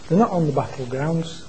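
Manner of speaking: usual speaking voice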